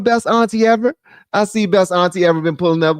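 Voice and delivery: ordinary talk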